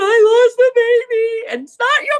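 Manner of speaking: high, wailing sob through the words; hammed-up crying, voice breaking high and loud; imitating sobbing. Client sobbing at the washbasin to the young shampoo girl, imitated crying. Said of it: "started bawling".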